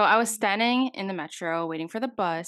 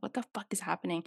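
hushed, voiceless bewilderment; soft retold whisper of disbelief; whispered shock; 8 dB quieter, faster than usual. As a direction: whisper it as a stunned inner thought. Inner shocked reaction retold.

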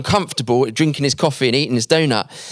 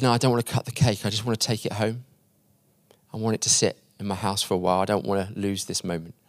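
soft, lowered voice, quietly moved; subdued and fragile retold plea; hushed, tender; 3 semitones lower, 7 dB quieter, faster than usual. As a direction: confide a small wish, almost under the breath. Agnes treasures the cake, emotional moment.

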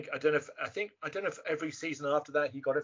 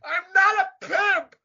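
high, loud, stretched-out wail; imitated protesting yell; 11 dB louder, much slower than usual. Actor quoting favorite end line, high protesting yell. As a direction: yell the denial high, drawing it out. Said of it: "him just going".

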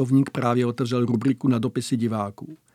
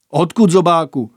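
loud impatient demand; 3 semitones higher, 7 dB louder than usual. A narrator's reading. Bogus interrogates recruit.

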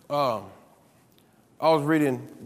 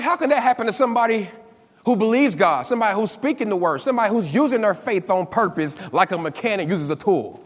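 louder, rapid incredulous complaint; retold indignant protest; 2 semitones higher, 4 dB louder than usual. Robbed woman protesting to the minister, imitated with loud indignation. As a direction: imitate a wronged believer, piling up each point.

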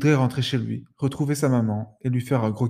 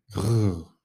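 low growl; 5 semitones lower than usual. Acted. Hidden animal growls.

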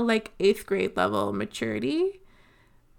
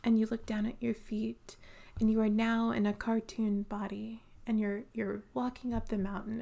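soft, hushed imitation of a meditation guide; soothing voice; 8 dB quieter than usual. Instructor guiding the sound bath meditation, soft soothing hush. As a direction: breathe it out gently, half whispered, like leading a class. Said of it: "in her beautiful, soothing voice".

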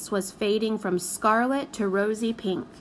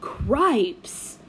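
gasped. A narrator's reading. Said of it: "gasped Solly".

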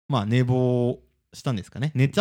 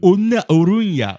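slow, drawn-out mock Kansai line, louder and higher; imitating Kansai dialect; 6 semitones higher, 8 dB louder, slower than usual. Mocking the co-host's Kansai slip on video, slow imitation. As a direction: parody the co-host's Kansai phrase, slowly. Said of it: "いてててて".

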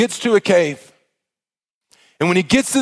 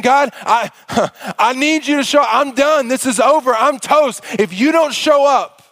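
higher, urgent pleading, slowing at the end; desperate plea; 8 semitones higher than usual. Acted. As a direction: beg God like a man at the end of his rope. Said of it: "He's in a true state of desperation".

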